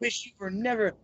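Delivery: mock macho boasting. Imitating rematch challenger threatening his opponent, mock macho. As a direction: puff it up like a cocky challenger. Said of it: "comes out all macho and everything".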